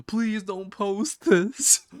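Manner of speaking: high, loud drawn-out plea; pleading. Acted. Humiliated kid begs not to be filmed.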